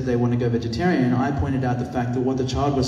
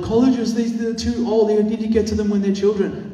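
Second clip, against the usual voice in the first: much higher, emphatic lecturing; quoting a lecturer, pitch raised high; quoted lecturer; 9 semitones higher than usual. Retold or imitated. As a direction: press the point like a lecturer.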